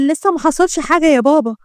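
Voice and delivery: usual speaking voice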